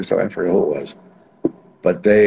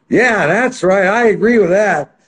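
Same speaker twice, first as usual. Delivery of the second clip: higher, louder backing up; loud cheering agreement; 10 semitones higher, 4 dB louder, slower than usual. Retold or imitated. Manager loudly backing his fighter at the press conference. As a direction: shout your support. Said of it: "I was like".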